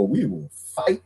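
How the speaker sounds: ordinary delivery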